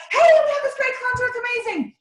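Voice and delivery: high, bright excited pitch. Shown as a demonstration. Coach showing a team member an excited pitch, high and lively. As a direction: sell it like you can't contain it. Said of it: "Two totally different, um, tones".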